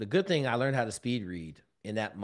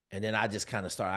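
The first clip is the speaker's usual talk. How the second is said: ordinary delivery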